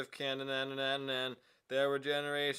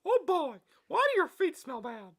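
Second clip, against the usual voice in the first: high squeaky cartoon mouse; squeaky falsetto cartoon voice; Mickey Mouse voice; 8 dB louder than usual. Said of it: "Mickey Mouse".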